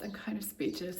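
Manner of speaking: normal talking voice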